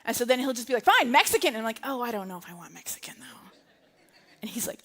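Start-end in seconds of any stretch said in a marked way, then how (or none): 0.8-1.5 louder, higher exasperated snap, imitated; frustrated husband. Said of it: "can you ever feel the frustration". 1.8-3.5 quick, soft whispery doubt; hushed reluctance. Retold or imitated. Acting out her doubts about Mexican.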